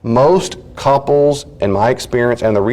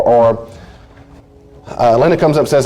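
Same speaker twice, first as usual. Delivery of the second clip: ordinary delivery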